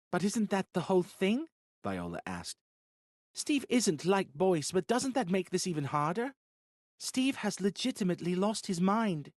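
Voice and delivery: high, worried pleading; anxious. Acted. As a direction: voice rising with worry. Viola anxiously objects.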